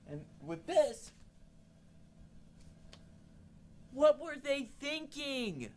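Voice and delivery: slow, drawn-out disbelief; exasperated. Real, not acted. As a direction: let the question hang in disgust. Exasperated at the design.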